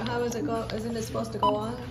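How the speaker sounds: ordinary delivery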